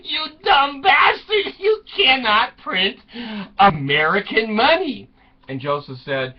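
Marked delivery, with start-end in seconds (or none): 0.0-5.1 mock outrage. Wife to husband planning to print money, mock outrage. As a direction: berate him, stretching out the insult.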